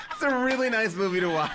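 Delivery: repeating the review through real laughter; cracking up mid-sentence; laughing. Real, not acted. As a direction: laugh out the line, amused.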